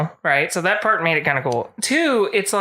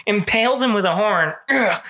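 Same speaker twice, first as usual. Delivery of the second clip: usual speaking voice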